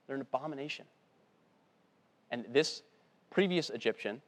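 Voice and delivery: ordinary talk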